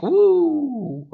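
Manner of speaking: high falsetto woo. Acted. Streamer cheers the long stream with a high woo. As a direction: whoop it up high.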